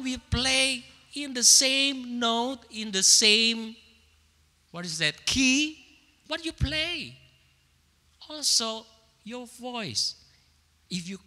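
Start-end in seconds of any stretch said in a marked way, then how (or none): none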